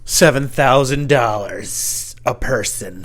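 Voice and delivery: drawn out, slow and showy; slow, hammy money boast; mock-grand boast. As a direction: stretch the figure out slowly for effect. Joke boast of big money.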